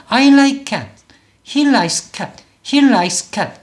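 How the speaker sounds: he likes cat with likes as one syllable; correct English syllables. Shown as a demonstration. Teacher models how it should sound, one-syllable likes. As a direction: end likes on "ks" with no vowel. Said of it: "이렇게 소리를 내야 된다는 거고요".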